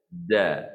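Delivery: "da" thin and open, pitched low; huruf dal nipis. Shown as a demonstration. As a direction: say "da" light, tongue kept off the palate. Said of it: "Tapi kita sebut huruf dal". (sebutan "da" nipis dan terbuka)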